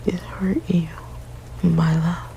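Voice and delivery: ordinary delivery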